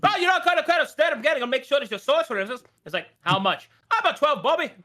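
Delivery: high, loud, fast rattling British; imitating an incredible British accent; pikey accent. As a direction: mimic the fast-talking traveller. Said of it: "he was just like".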